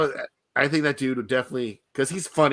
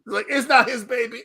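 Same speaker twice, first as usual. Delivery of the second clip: laughing; 12 semitones higher, 5 dB louder than usual. Real, not acted. Laughing about paternity joke.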